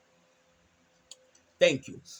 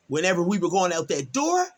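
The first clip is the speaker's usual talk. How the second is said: ordinary delivery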